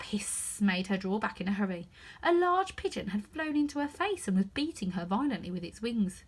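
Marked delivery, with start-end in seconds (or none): none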